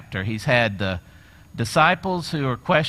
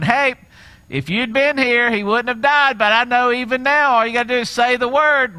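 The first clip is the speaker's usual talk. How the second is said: a higher, louder insistent Martha; voicing Martha; 9 semitones higher, 6 dB louder than usual. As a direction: throw Martha's challenge at Jesus. Acted Martha confronting Jesus.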